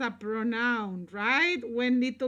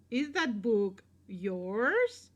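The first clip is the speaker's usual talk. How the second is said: ordinary delivery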